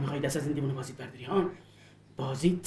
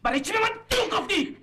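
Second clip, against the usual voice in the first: much higher, loud yelled repeat; furious shout; 3 dB louder than usual. Acted. The man shouts the same question at the woman, furious. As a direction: explode on the repeat.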